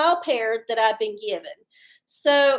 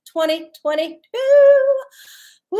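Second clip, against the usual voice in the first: higher, louder and slow, stretched out like a hype host; hyped announcement; 11 semitones higher, 9 dB louder than usual. Real, not acted. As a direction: belt out the year, then cheer.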